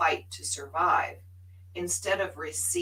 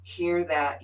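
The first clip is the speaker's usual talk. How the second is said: usual speaking voice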